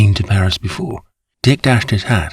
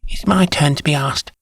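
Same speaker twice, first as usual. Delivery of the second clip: put-on baby voice; 7 semitones higher than usual. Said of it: "call out in a baby voice".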